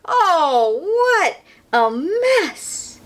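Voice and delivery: high slow drawn-out dismay. Acted. Reader groaning at the mess for kids. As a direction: sing out the mess.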